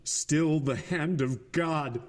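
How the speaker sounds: slow, high cry of despair; a father crying out over his dead son; anguished cry. A narrator's reading. Father crying out to God over his dead child, slow and high. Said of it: "a piercing cry escaped ... he cried".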